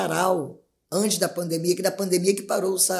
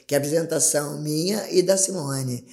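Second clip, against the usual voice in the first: ordinary talk, nothing marked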